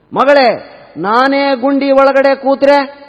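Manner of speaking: loud, high, slow drawn-out question; escalating mother-in-law. Acted. Mother won't stop testing the bride, loud insistent challenge.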